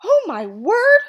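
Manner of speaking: high, loud, drawn-out shriek; horrified mother's shriek; scream. Acted. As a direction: scream in horror, stretching the words. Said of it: "Mama screamed".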